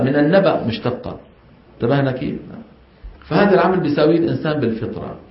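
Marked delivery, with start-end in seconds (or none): none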